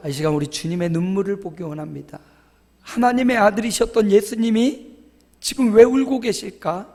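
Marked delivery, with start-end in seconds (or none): none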